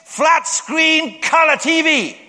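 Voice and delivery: high, loud, slow excited shout; mock shout. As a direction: shout the wish out like a thrilled woman. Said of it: "she shouted out".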